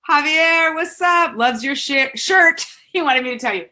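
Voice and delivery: high, loud greeting through real laughter; laughing hard. Real, not acted. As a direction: call out to a commenter while cracking up. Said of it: "I'm laughing so hard".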